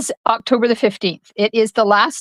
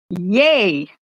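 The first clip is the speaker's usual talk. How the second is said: normal talking voice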